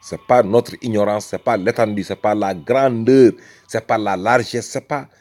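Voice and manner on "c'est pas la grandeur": ordinary talk, nothing marked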